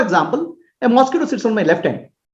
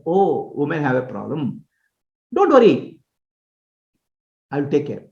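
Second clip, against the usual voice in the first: soft, lower, slow comforting voice; calm reassurance; 7 semitones lower, 3 dB quieter than usual. Retold or imitated. Lady doctor soothing a woman in labour, soft and calm. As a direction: settle a frightened patient gently.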